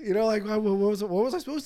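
usual speaking voice

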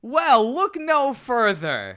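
high, loud, slow salesman pitch; hammy TV pitchman reveal; infomercial host. Pretend. Infomercial skit pitch line.

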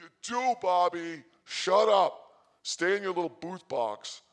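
high and loud, shutting Bobby up; loud scolding. Retold or imitated. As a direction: bark at Bobby to shut up. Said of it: "And I'm like".

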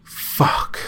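sharp frustrated outburst; frustrated curse. Acted. Zach cursing himself after cutting off his rant. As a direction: spit it out at yourself.

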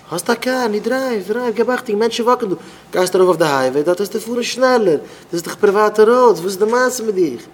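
high-pitched, agitated telling-off; acted scolding. Telling a driver off about speeding, exasperated.

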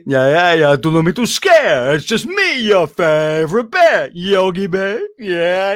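friendly showman bear; Yogi Bear voice. Retold or imitated. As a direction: boom it out as a cheerful bear. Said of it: "you'd hear".

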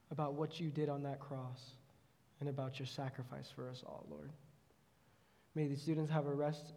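soft, earnest prayer voice. Acted. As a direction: pray quietly and sincerely over the students. Leads a closing prayer.

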